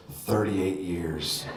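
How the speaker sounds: very low, very slow, quiet and tired; weary old principal. Retold or imitated. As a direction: drag it out like a worn-out principal.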